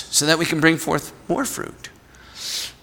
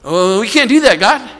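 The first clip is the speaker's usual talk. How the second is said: high, louder whiny protest; doubting disciples; 8 semitones higher, 6 dB louder than usual. Retold or imitated. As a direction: plead it's impossible, pitched up. Disciples protest to God, retold.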